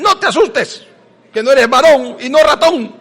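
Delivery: high teasing parent with a laugh; mocking parent. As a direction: taunt the scared boy like a parent. Padres se burlan del niño asustado. (imitando a un padre que se burla del niño asustado, agudo y con risa)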